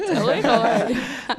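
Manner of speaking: laughing. Real, not acted. Forgetting word, laughing.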